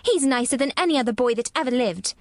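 put-on a child hotly defending her friend; heated defence. A narrator's reading. Girl defends her friend to sick boy, heated. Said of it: "Mary's eyes flashed fire".

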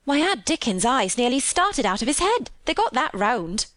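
thrilled country housemaid. Acted. Maid imagining her siblings' amazement, thrilled. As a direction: marvel like an excited country maid. Voices Martha in dialect.

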